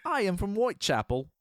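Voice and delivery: mock British accent, high; hammy London accent; lightly done Whitechapel accent. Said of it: "why is he doing that".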